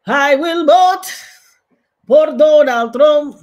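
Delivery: mock voice. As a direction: lampoon the campaign chant. Mocking Latinos for Trump.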